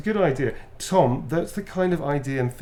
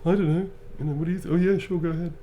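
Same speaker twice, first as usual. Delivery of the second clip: lightly higher, vague and easygoing; dopey character; 6 semitones higher, faster than usual. Retold or imitated. Player voices his dim character answering, light and vague. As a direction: shrug it off like a dim-witted dreamer. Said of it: "it's like".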